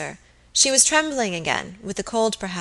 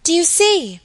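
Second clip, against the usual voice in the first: high, loud, urgent question; alarmed cry; 9 semitones higher, 7 dB louder than usual. A narrator's reading. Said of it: "cried Phoebe, pointing".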